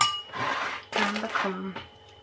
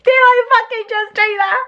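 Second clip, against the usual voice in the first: shocked disbelief; 7 dB louder than usual. Retold or imitated. Vlogger recalling watching her head shave, shocked. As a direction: gasp it out in disbelief.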